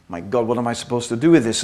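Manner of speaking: low, quick, muttered panic; overwhelmed at the posh table; DiCaprio's bewildered aside. Retold or imitated. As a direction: mutter it fast like a lost guest. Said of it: "he sits there like".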